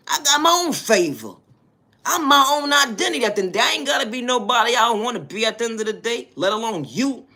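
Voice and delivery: high, loud impassioned shouting; defiant proclamation. Real, not acted. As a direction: holler it like a fired-up preacher. Impassioned defiant declaration to detractors.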